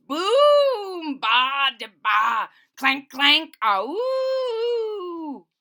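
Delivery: booming, clanking noise sounds shouted high and loud; crashing boom and clank sounds with a rising ooh; loud spooky noises. Acted. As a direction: bang out the scary noises behind the corner. Narrator voices scary noises.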